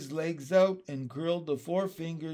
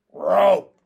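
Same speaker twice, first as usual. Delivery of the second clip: loud, strained frog croak; frog call with half a voice; 4 semitones higher, 14 dB louder than usual. Acted. Pin trying to sing with one vocal sac, loud strained frog call. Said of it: "he sang with half a voice".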